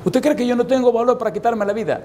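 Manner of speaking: a hint of an offended young woman; girl insisting to the pastor, pitched high; indignant girl. Girl challenges him.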